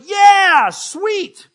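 high, loud mock-happy yeah sweet; sarcastic cheer. Older brother mocking the homecoming, sarcastic loud cheer. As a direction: fake cheer, dripping sarcasm. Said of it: "the older brother was like".

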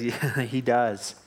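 amused, drawn-out confession; laughing admission. Retold or imitated. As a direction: chuckle through the words, slow. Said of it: "they're all laughing".

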